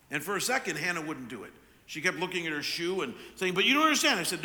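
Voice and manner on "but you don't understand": loud, high imitation of an upset toddler; mock tantrum protest. Daughter still staring at her shoe, mock tantrum protest. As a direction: burst out as a frustrated child.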